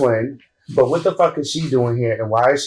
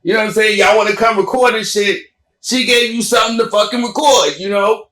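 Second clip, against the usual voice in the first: much higher, fast and taunting; worked up; 8 semitones higher, faster than usual. Real, not acted. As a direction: taunt them, pitch up and rush it. Mocking the girls who filmed.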